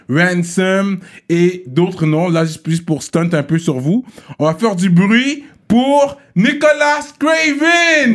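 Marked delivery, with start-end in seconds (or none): 4.4-8.1 high, drawn-out announcer call; hype introduction. Real, not acted. Host introducing the beatmaker to listeners, rising hype shout. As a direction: stretch it out like a ring announcer. Host hypes crowd for guest.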